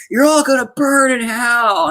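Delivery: mock high-pitched damning cry; retold headmaster yelling damnation, high and drawn out; headmaster's rant. Headmaster condemning the people walking off, mock ranting.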